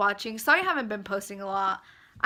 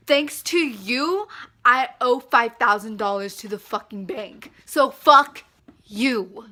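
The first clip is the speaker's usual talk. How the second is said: furious retort; 8 dB louder than usual. Retold or imitated. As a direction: spit the retort back at her. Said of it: "what I texted that bitch back".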